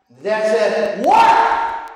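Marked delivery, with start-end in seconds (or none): 1.0-1.9 loud, very high mock shout; exasperated dad. Dad at his son's third call, exasperated mock shout. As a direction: blow up in comic exasperation, voice shooting up.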